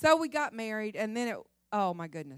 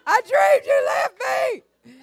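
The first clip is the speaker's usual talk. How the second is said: much higher, louder mock wail; hysterical wail; 11 semitones higher, 12 dB louder, slower than usual. Wife wailing to husband about her dream, hammed-up shout. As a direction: shriek it like a frantic dream-shaken wife.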